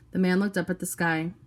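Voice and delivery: plain reading voice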